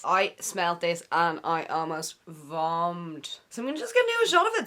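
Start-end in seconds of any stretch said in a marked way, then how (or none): 3.5-4.7 high-pitched fast squeak; squeaky high voice. Pretend. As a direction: squeal it out quickly. Sudden squeaky high rush.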